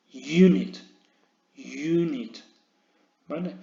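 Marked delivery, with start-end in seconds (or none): 0.1-2.4 unit said as junit with a clear y glide; y sound. Shown as a demonstration. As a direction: start unit with a y sound, like you-nit. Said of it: "suenan como si fueran una Y".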